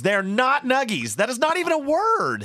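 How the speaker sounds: high, emphatic protest; indignant objection. Real, not acted. Co-host disputing a made-up word, indignant. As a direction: reject the word in rising indignation.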